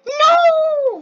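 very high, loud, drawn-out "no"; anguished scream. Real, not acted. Player failing right as they were doing it, anguished scream. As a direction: scream in dismay, holding the vowel. Fails right after boasting.

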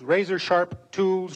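razor-sharp tools drawn out slowly; slow savoring repeat. A narrator's reading. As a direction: linger on the words like eyeing the blades. Repeated phrase in narration.

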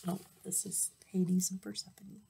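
breathless hushed whisper; a quick secret whisper off the page; whispered aside. Real, not acted. Storyteller whispers an aside to the listener, hushed.

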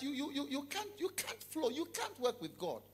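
ordinary talk, nothing marked

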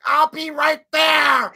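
loud, high, drawn-out angry wife; mock furious yell. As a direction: stretch it into an imitated threatening shout. Angry wife on phone, retold.

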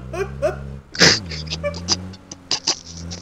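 laughing. Real, not acted. Long laugh.

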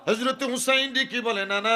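high calling sermon tone; impassioned preaching. Acted. Preacher voicing Husain calling his grandfather, high call. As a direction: call out high, stretching the last word.

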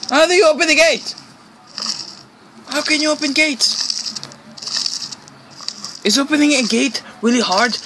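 stuck and scared, voice pushed way up; panicked and frustrated. Real, not acted. Gamer unable to open the gate, high frantic complaining.

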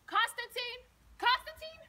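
high, loud call rising on the repeat. Acted. Mother calls for her son.

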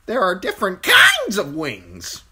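high, loud bird squawk; insistent crow. A narrator's reading. Crow explaining wings to Bran, insistent squawk. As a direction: stress KINDS in a piercing bird voice.

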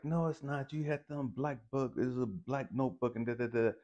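high-pitched imitation of her arguing; arguing woman. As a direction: mimic her insistent contradiction. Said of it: "we got into an argument".

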